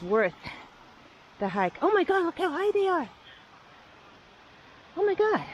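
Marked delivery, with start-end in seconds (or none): 1.8-5.4 high-pitched excited amazement; real excitement. Real, not acted. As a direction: exclaim in awe, voice leaping high. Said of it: "I'm a little excited here".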